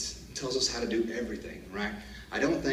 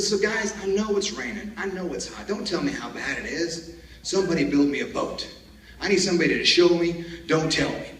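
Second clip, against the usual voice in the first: raised, higher-pitched motivational address; rousing troop speech; 7 semitones higher, 5 dB louder than usual. Retold or imitated. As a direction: re-enact rallying the troops to act. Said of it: "get these guys fired up".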